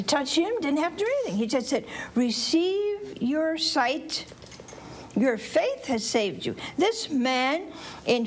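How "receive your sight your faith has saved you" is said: very slow, deliberate proclamation; solemn pronouncement. Retold or imitated. Jesus tells the blind man he is healed, solemn pronouncement. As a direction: draw out the healing words.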